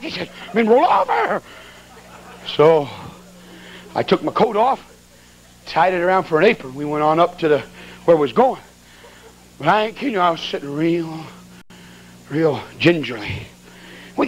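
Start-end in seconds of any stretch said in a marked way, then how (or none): 0.5-1.4 high, exasperated mock holler; high-pitched, stretched urgent call; urgent cry. Missionary now urging him loudly. 10.7-13.4 slow, careful, drawn-out words; low and tentative, stretching it out; gingerly. Retold or imitated. Describes sitting carefully on scraped seat.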